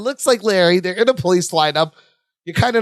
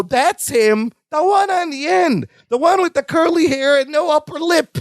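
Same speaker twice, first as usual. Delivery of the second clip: high-pitched imitation of a witness pointing someone out; Missus Marshall; 8 semitones higher than usual. Robbery victim picking out the man in the lineup, high mock accusation. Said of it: "you hear Missus Marshall go".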